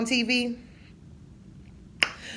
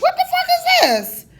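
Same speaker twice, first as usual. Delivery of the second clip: high, loud disbelieving yell through laughter; outraged and amused at a bad burger; incredulous laughing shout; 5 dB louder than usual.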